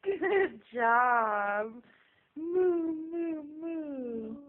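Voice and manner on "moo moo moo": high loud mooing; cow moo. Shown as a demonstration. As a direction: moo like a cow to cheer the baby. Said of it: "What's a cow say?".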